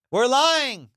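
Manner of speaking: emphatic repeat. Real, not acted.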